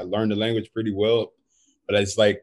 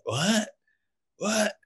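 high, soft questioning squeak; mock incomprehension; 8 semitones higher, 7 dB quieter than usual. Finns reacting to his slightly wrong word, mock baffled. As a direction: imitate someone acting baffled, pitched up and quieter. Quoting people feigning incomprehension.

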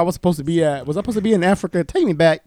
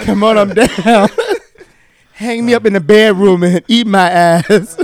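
laughing; 5 semitones higher, 7 dB louder than usual. Real, not acted. Hamming up a joke, laughing.

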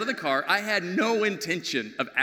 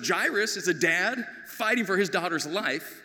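ordinary talk

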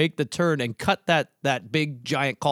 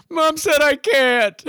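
put-on kid yelling back; 9 semitones higher, 6 dB louder, slower than usual. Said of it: "my six-year-old just yelled back".

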